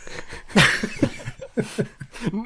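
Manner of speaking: quiet breathy suppressed laugh; stifled giggles. Real, not acted. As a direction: giggle silently into your hand. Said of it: "hört auf zu lachen".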